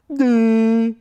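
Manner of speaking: high, loud silly vocal noise; dad's sound effect. Shown as a demonstration. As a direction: burst out with dad's signature goofy sound. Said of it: "this expression and sound effect".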